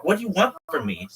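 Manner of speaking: high, loud plea; exasperated whine. Retold or imitated. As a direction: throw up your hands. Mashiach exasperated.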